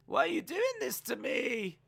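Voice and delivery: high-pitched mock plea; Mandy Rose impression. Host mimicking a wrestler on the floor, high mock plea. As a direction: imitate a wrestler's high, whiny cry. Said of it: "just Mandy Rose on the floor going".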